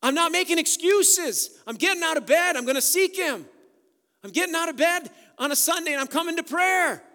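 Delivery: fiery vow shouted to the church. Retold or imitated. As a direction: proclaim it at full preaching force. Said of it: "those that say".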